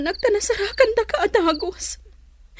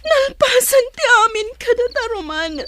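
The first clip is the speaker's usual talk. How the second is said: crying; 3 semitones higher than usual. Acted. Estela ending it with Roman.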